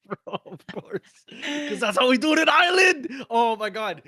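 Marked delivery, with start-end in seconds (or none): 1.7-4.0 high, loud hype yell; excited shout. Real, not acted. Hyped about an Irish brawl.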